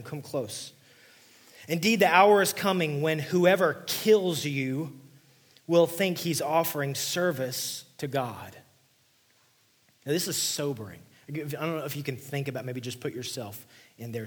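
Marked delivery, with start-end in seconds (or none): 1.7-8.6 loud, slow, ringing proclamation; preacher thundering the verse; forceful scripture reading. Acted. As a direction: read it out like a prophecy.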